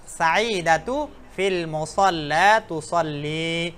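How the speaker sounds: very slow, deliberate Arabic; slow recitation. Shown as a demonstration. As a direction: stretch every syllable for the class. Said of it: "মা বলছে".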